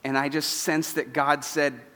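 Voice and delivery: ordinary talk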